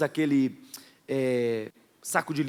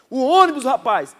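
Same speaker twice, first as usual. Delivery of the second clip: high, loud urgent yell; urgent call; 8 semitones higher, 9 dB louder than usual. Retold or imitated. Pastor urging friend to catch the bus, loud. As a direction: shout to hurry him up.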